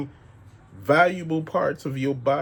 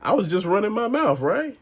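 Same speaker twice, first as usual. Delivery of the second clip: mock innocent teasing; 8 semitones higher than usual. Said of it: "he looking at me getting mad".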